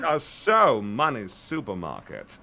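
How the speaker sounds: slow, drawn-out British actor voice; Matt Berry. Acted. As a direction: stretch it out with theatrical relish. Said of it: "your Matt Berry impression".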